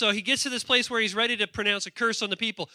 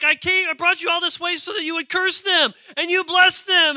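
higher, louder furious complaint; angry king; 11 semitones higher, 10 dB louder than usual. Acted. The king yelling at Balaam, an angry loud complaint. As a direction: rage at the prophet who failed you. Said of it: "He's like".

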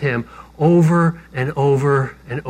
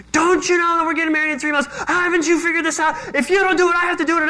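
high-pitched mock tirade, rattled off in a frantic rush; hammed-up high, breathless nagging outburst; unglued rant; 12 semitones higher, faster than usual. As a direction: imitate a fiancée losing it, voice shooting way up. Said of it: "she comes unglued".

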